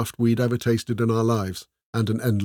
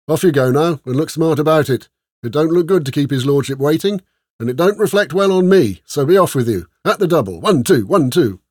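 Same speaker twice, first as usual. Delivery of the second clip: loud, raised drill-sergeant command; barked sergeant's orders; 5 semitones higher, 7 dB louder than usual. A narrator's reading. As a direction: send the men off at the double.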